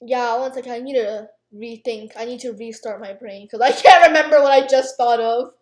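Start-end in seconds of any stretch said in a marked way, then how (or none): none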